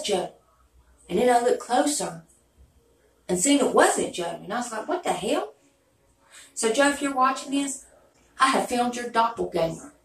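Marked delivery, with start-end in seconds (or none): 4.9-5.5 retelling her shock, pitched much higher; a startled, higher-pitched what the hell; surprised. Retold or imitated. Recalling seeing Joe's lookalike on YouTube, surprised and high.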